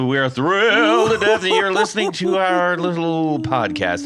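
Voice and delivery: high, loud, thrilled host; gushing welcome. Acted. Host gushes in show opening.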